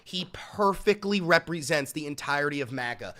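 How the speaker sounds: ordinary talk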